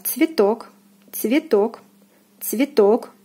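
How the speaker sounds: цветок with full stressed "o"; careful model цветок, weight on о. Shown as a demonstration. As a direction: say цветок as "tsvi-TOK", falling. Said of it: "O is stressed".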